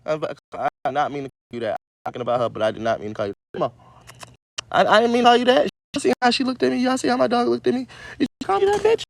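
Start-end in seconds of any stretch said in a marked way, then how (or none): 4.7-9.0 much higher, mock-scolding the dog; high squeaky scold at the dog; high pet-talk voice. Real, not acted.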